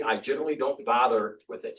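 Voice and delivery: usual speaking voice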